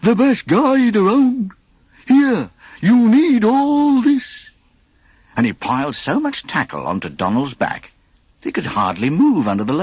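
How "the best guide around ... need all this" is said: high, slow, goofy drawl; slow goofy shopkeeper voice. A narrator's reading. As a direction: play the dopey cheerful guide. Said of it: "the shopkeeper said".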